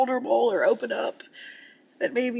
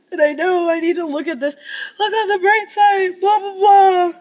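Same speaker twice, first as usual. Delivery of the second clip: crying laugh; 6 semitones higher than usual. Real, not acted. Crying, mocking own advice.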